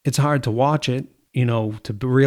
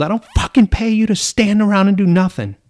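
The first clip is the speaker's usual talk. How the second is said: loud, higher angry yell at a worker; retold yell; 9 semitones higher, 6 dB louder than usual. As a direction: bark it like the boss over the camera speaker. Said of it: "And I just yelled".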